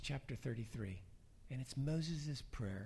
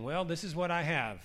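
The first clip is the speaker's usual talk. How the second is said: self-confident; 4 semitones higher, 6 dB louder than usual. Acted. Acted self-confident boast before Jesus.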